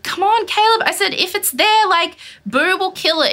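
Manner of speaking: high-pitched exasperated protest; imitated exasperated reasoning. She talks partner out of chasing mouse, exasperated. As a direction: argue back, fed up and pleading. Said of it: "I was like".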